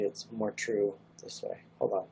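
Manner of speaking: normal talking voice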